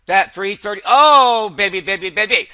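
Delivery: ordinary talk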